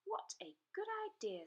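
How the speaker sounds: high eager dragon pupil; Zog's voice. A narrator's reading. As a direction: pipe it up gladly. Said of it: "said Zog".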